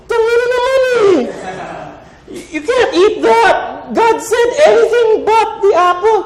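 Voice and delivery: very high, loud, laughing panic; frantic high Eve. Acted. Eve panicking to Adam about the apple, very high and loud. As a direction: shriek in comic panic.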